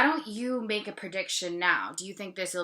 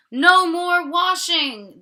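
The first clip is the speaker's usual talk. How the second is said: much higher and louder, slow cry; animals cheering; 11 semitones higher, 14 dB louder than usual. Acted. The animals cheer as the tub goes away, loud. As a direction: shout it out like freed farm animals. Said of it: "the animals say".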